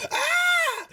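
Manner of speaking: shrill falsetto scream; high-pitched acted shriek; halfling's scream. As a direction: scream in terror as a tiny halfling.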